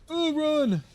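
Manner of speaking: high-pitched, loud call to run; mock alarm cry. Player reacting after pushing the red button, mock alarm. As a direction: cry out high and drawn in play panic. Streamer mock panic in game.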